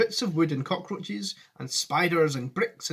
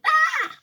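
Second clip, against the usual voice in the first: put-on high, loud old lady's scream; Grandma Georgina screaming as the doors close, high and loud; 6 dB louder than usual. A narrator's reading. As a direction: shriek in fright. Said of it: "Grandma Georgina screamed".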